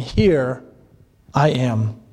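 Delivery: low, slow comfort; deep, gentle divine reassurance; comforting Lord. Acted. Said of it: "the Lord comforts them".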